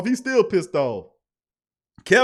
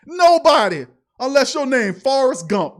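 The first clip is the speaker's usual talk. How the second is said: high, loud outburst; incredulous exclamation; 6 semitones higher, 8 dB louder than usual. Real, not acted. Fan ridiculing the coach's play action, loud incredulity. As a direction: belt it out in disbelief.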